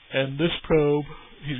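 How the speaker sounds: words stretched by a yawn. Real, not acted. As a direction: say it mid-yawn. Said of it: "Excuse me, I yawned".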